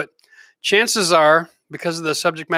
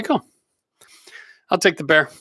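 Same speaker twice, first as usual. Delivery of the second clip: ordinary talk, nothing marked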